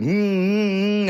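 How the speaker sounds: high, strained closed-mouth mm cries trying to scream; muffled scream. Retold or imitated. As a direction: push a scream out through a shut mouth. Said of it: "trying to scream".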